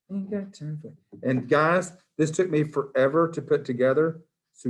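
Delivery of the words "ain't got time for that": sassy meme line. Pretend. Repeating meme, higher voice.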